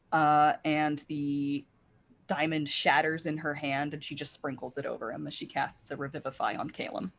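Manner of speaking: ordinary delivery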